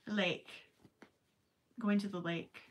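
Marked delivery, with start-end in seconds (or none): none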